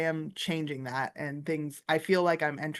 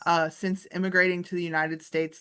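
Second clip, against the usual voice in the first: ordinary talk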